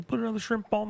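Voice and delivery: high, hammed-up Australian impression; exaggerated high-pitched Australian; Australian movie-quote accent. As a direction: quote it in a mock Aussie accent. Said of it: "in Dumb and Dumber, he says".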